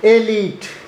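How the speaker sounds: एलीट as eh-LEET, long ee; correct pronunciation. Shown as a demonstration. Teacher shows students how to read it, slow and clear. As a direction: model एलीट slowly, stress on LEET. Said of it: "इसे हम ऐसे पढ़ेंगे".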